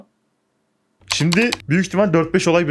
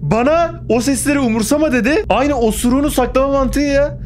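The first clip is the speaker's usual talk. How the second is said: high, raised voice over the noise; raised, high ranting voice; talking loudly; 9 semitones higher than usual. Real, not acted. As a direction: talk over the din on purpose. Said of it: "Bağıra bağıra konuşayım böyle".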